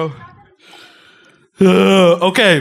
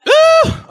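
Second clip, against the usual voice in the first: sharp high cry of pain; pained yelp; 7 dB louder than usual. Real, not acted. Reaction to scorpion heat.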